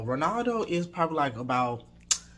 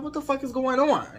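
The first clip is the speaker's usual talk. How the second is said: high-pitched, amused disbelief; retold baffled reaction, breaking into a laugh; bewildered; 10 semitones higher than usual. Reacting to a stranger on the porch, bewildered.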